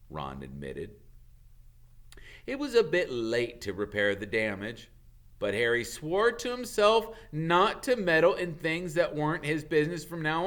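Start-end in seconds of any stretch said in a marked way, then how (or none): none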